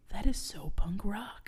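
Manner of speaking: slow reverent whisper; whispered awe. Retold or imitated. Host quoting Dewey in hushed awe, whispered. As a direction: breathe it in wonder. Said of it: "And he's just like".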